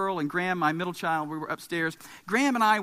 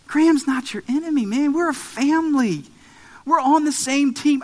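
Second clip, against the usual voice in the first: high, loud fatherly insistence; urgently convince your son he's family; raised voice, pleading earnestly; impassioned plea; 6 semitones higher, 7 dB louder than usual. Acted.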